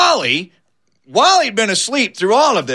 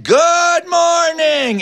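high, stretched-out singsong cry; happy wake-up call; 11 semitones higher, much slower than usual. Acted. Drug-addled Wally wakes among skunks, happy singsong. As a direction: sing out a long, high morning greeting. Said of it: "he thought it was morning".

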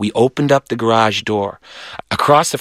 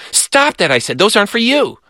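scolding; 11 semitones higher than usual. Retold or imitated. Catching brother eating peanuts.

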